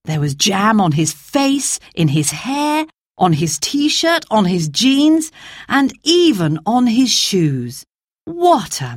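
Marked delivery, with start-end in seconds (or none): none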